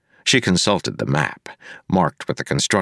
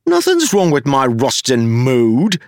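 higher, louder indignant Wayne; defensive demand; 7 semitones higher, 4 dB louder than usual. A narrator's reading. Wayne defensively snapping back at Marcy. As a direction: bristle back at her. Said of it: "he demanded".

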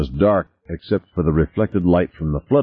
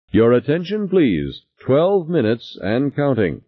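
intercom announcer; 8 semitones higher than usual. A narrator's reading. Habitat intercom announces countdown.

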